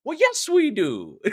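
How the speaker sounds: high, slow mock Irish lilt; hammed-up Irish, stretched out slowly; Saint Patty's accent. Host trying next month's accent, playful mock Irish. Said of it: "That's the accent for Saint Patty's".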